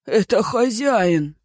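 high, slow tearful cry; sobbing cry. A narrator's reading. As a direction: cry it out with a sob. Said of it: "всхлипнул".

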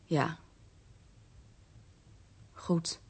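very low and soft; hushed, resigned single words; subdued acceptance. Acted. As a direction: let the voice sink as it registers. Widow takes in the news.

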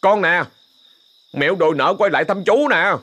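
calling out. Acted. Calling out at the door.

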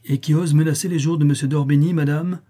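bold, high, loud retort; audacious challenge. A narrator's reading. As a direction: throw the question back boldly.

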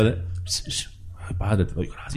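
hushed mock-devout thanks; mock pious prayer. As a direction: breathe it like a showy hypocrite praying. Mock pious hypocrite praying.

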